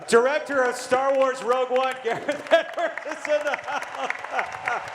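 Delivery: high, loud announcer's reveal breaking into laughter; excited announcement. Real, not acted. Host reveals the director to the crowd, excited shout.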